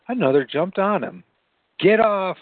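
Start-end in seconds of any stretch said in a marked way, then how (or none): 1.8-2.4 high-pitched cry to get away; narrated shriek, pitch jumping up. A narrator's reading. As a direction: cry out, fending off an attacker. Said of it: "he shrieked".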